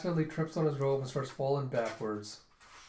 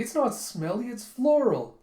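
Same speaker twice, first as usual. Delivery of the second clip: much higher, louder, offended correction; tea-grower indignantly correcting the general; indignant protest; 10 semitones higher, 6 dB louder than usual. Retold or imitated. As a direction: defend your precious tea. Defending his tea indignantly.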